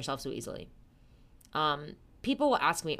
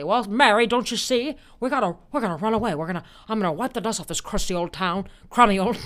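loud, urgent old-movie hero impression; George Bailey; 3 semitones higher, 6 dB louder than usual. Shown as a demonstration. Telling sweetheart they're leaving town, old-movie hero impression. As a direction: plead like an excitable classic film lead. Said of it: "my George Bailey".

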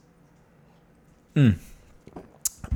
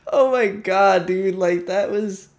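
high, laughing oh my God; 8 semitones higher, slower than usual. Real, not acted. Host laughing while telling about cleaning poop. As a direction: laugh it out in disbelief.

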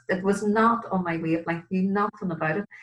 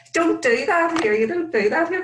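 laughing warning; 12 semitones higher, 8 dB louder than usual. Retold or imitated. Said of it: "I laughs said".

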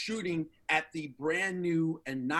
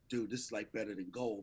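hushed awe; 7 semitones lower, 8 dB quieter than usual. Retold or imitated. Buddy quoted, grateful, hushed.

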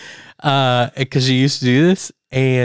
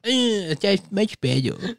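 mock smug voice; 6 semitones higher, 6 dB quieter, slower than usual. Mocking friend's fart habit.

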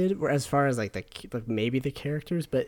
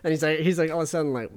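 ordinary talk, nothing marked

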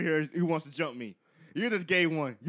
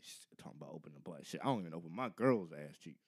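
very low, soft grumble; mumbling; 12 semitones lower, 13 dB quieter than usual. Acted. Ringleader grumbles to himself about the odd order, mumbling. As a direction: mumble indignantly under your breath. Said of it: "ringleader over here mumbling and shit".

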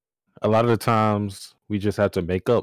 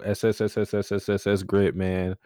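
long hissing s before Gridman. Acted. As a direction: hiss the s like a snake. Drawn-out s stutter.

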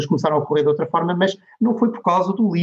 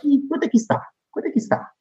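imitated demanding questioner, raised pitch; retold sceptical query, pitched up; pressing question; 11 semitones higher, much faster than usual. Finance directors pressing marketers for returns, imitated demand.

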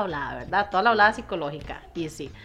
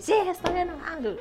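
put-on sulky child; 9 semitones higher than usual. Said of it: "Y él va a decir".

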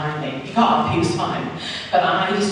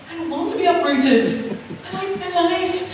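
much higher, whining protest; whiny pleading; 12 semitones higher than usual. Retold or imitated. Telling God she won't be uprooted, a higher whiny plea. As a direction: beg like a reluctant child.

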